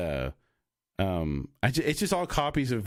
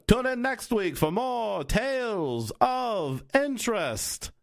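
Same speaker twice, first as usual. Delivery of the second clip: high, drawn-out newsreel announcer voice; old-timey announcer; 11 semitones higher, much slower than usual. Shown as a demonstration. Podcaster showing off his radio play part, old-timey announcer voice. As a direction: stretch each word out like a vintage newsreel announcer. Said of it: "So that was my announcer voice".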